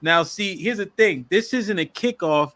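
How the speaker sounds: ordinary talk, nothing marked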